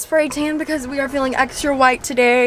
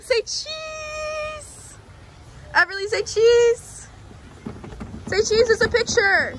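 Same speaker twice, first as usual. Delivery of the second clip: calling for a photo; 10 semitones higher, 4 dB quieter, much slower than usual. Real, not acted. Mom calls her kids to say cheese for a picture, in a high call. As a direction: get them to smile for the camera.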